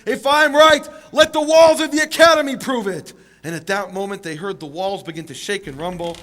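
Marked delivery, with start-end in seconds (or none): 0.1-3.1 much louder and higher yell; desperate yell. Retold or imitated. Rabbi in desperation yelling at the academy walls. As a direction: throw it out in desperation. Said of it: "he yells out".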